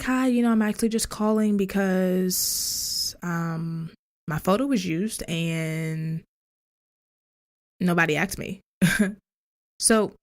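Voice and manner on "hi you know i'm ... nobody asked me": sweet customer-service telephone voice. Retold or imitated. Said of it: "this is my telephone voice".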